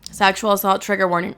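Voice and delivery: ordinary talk, nothing marked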